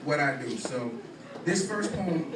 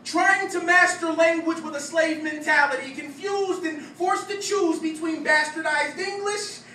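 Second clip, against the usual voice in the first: higher, louder, rhythmic slam-poem delivery; spoken-word poetry; 13 semitones higher, 5 dB louder than usual. Acted. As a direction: perform it as a punchy stage poem.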